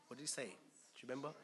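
ordinary delivery

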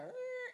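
high-pitched imitated car horn; high honk. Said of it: "make a point with your horn".

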